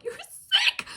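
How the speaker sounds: high laughing squeal; laughing at her own crying count; laughing outburst. Real, not acted. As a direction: burst out laughing at yourself.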